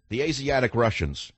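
ordinary delivery

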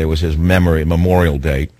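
usual speaking voice

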